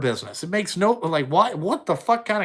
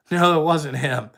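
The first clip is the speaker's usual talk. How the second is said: usual speaking voice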